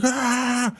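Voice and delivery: high, drawn-out yell without much volume; high-pitched retold scream; mock scream. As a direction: imitate a man screaming helplessly. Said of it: "He's just screaming".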